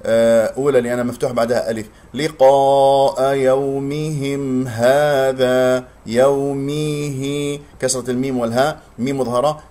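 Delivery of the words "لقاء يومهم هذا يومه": لقاء chanted very slow and flat-lipped; correct melodic recitation. Shown as a demonstration. Teacher modelling correct recitation, slow melodic chant. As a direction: recite لقاء يومهم هذا slowly in tajweed.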